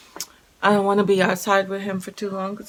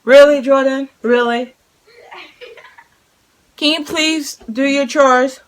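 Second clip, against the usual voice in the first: louder, higher mom scolding; exasperated scolding; 5 semitones higher, 8 dB louder than usual. Real, not acted. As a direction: let them know you're fed up. Scolding kids.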